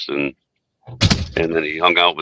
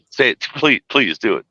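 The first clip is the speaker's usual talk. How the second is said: normal talking voice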